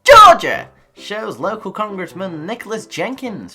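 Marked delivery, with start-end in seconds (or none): 0.0-0.7 very loud, high bellow; explosive comic shout; sudden mock yell. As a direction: holler the place name out of nowhere. Repeats place name, then shouted.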